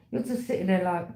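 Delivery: ordinary delivery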